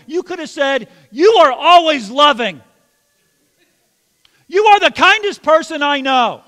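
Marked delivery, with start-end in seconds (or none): 1.1-6.4 loud, high accusing yell. Shown as a demonstration. Pastor yelling kind words in an angry tone. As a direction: yell the compliment like an attack. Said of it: "with the tone you ha".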